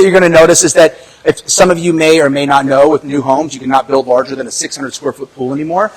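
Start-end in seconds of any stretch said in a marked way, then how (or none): none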